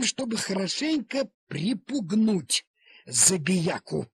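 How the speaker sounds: ordinary delivery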